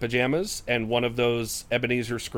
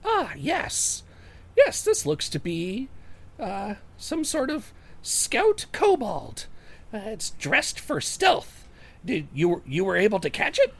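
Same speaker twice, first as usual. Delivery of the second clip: high, unhurried halfling voice; examine and pronounce in a small, high voice; studious halfling Jorik; 9 semitones higher than usual. Acted. Halfling Jorik studies the dead creature, thoughtful.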